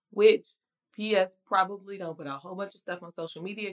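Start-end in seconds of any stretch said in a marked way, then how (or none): none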